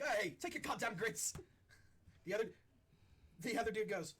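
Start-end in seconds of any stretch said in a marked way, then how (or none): 0.0-1.3 higher, slow, gruff pirate bark; pirate giving up his grits, grumbling. Acted. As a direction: shove the grits at him, grumbling. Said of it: "they're like".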